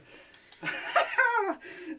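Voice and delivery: high-pitched nervous laughter; giggling helplessly; high burst of laughter. Real, not acted. Singer cracks up.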